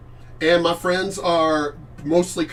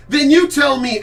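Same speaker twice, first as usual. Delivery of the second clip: loud challenge; 9 semitones higher, 7 dB louder than usual. Real, not acted. Streamer daring a troll to answer, loud challenge. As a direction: raise the voice and dare them to answer.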